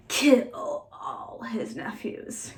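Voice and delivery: very slow, mostly voiceless villain delivery; slow, sinister near-whisper; psychopath's verdict. Acted. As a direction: draw out the murderous plan. Narrator voices psychopath's solution.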